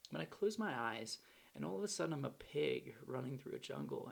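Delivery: ordinary delivery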